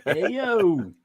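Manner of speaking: Nick's greeting. Acted. Echoing friend's impression of a streamer, swooping greeting. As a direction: mimic his catchphrase with a big rise and fall. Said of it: "if you're doing a Nick".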